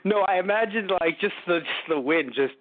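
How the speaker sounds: ordinary talk, nothing marked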